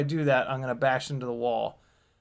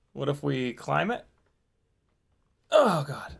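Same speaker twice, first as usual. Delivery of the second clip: usual speaking voice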